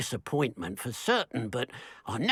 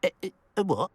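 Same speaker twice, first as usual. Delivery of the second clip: high, startled stutter; stammering surprise; 6 semitones higher, much faster than usual. Acted. Villager stunned to hear of a niffler, stammering. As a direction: caught off guard.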